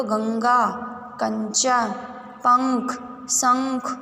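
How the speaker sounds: slow nasal demo of गंगा, कंचन, पंख, शंख with the nasal sound drawn out; नाक से उच्चारण. Shown as a demonstration. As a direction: say गंगा, पंख, शंख slowly, letting the sound ring through the nose. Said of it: "जैसे आप ma'am के साथ रीड करो". (नाक से ध्वनि निकालते हुए धीरे-धीरे पढ़ना)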